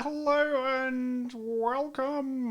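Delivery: high, slow, drawn-out silly greeting. Pretend. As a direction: stretch out a goofy welcome. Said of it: "I've gone into a silly voice".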